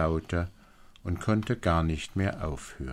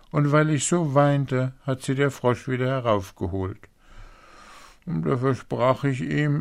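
high, louder, anxious princess voice; upset young princess; 7 semitones higher, 5 dB louder than usual. Acted. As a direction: pour out the confession to father, pitched up. Plays the princess confessing. (aufgeregte Prinzessin, hoch und laut)

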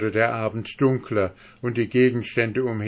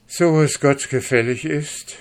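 loud, raised-pitch vow; solemn hope; 7 semitones higher, 7 dB louder than usual. A narrator's reading. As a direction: declare it aloud, high and hopeful. Dwarf's hopeful vow before the spell.

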